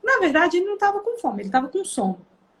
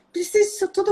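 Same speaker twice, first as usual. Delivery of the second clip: higher-pitched questioning aunt; disapproving aunt; 7 semitones higher, faster than usual. Retold or imitated. Aunt questioning the mother's strictness, disapproving. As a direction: imitate an aunt's protest, pitched up. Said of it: "E ela fala".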